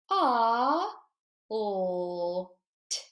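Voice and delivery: segment each sound clearly. Shown as a demonstration. Teacher decoding fault with the class, sound by sound.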